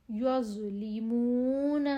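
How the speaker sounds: یظلمون with a full, heavy ظ, very slow; thick zoe. Shown as a demonstration. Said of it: "زو کی آواز موٹی اور نرم ہوتی ہے".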